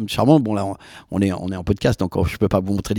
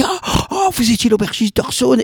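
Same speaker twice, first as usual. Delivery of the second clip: much higher, starstruck gushing; squealing high mock fan; excited fan voice; 12 semitones higher than usual. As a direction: imitate an awed fan, high up. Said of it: "il me dit".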